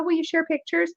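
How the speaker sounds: ordinary talk, nothing marked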